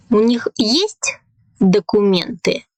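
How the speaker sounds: sharp rise on "есть", then drop; rising question intonation. Shown as a demonstration. Teacher demonstrating a Russian question to learners, pitch jumping up on "есть". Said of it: "here we have a rising intonation".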